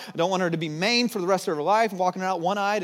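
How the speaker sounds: usual speaking voice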